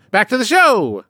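high, loud sing-out announcement; calling out. Real, not acted. Announcing the return to the episode, high and loud. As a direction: belt it out like a show host.

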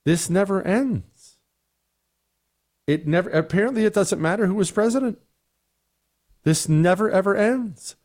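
real frustration rising; exasperated. Real, not acted. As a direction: sigh it out, fed up. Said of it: "I'm getting frustrated".

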